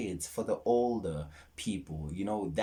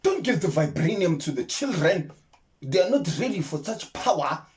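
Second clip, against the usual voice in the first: higher, louder mock Wakandan accent; Wakandan movie accent; 10 semitones higher, 7 dB louder than usual. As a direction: declaim like a Wakandan elder. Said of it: "how it sounded when they did those accents".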